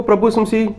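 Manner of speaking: usual speaking voice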